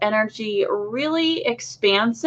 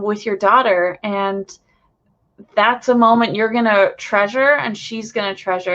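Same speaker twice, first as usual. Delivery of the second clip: ordinary delivery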